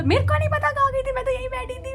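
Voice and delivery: high, tearful whimper; crying plea. Retold or imitated. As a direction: plead in tears. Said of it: "मैं बस रोती गई". (ऊँची रोती हुई आवाज़ में सफ़ाई)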